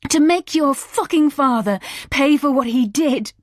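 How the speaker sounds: high, loud raging scream. A narrator's reading. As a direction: scream it in rage. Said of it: "I screamed".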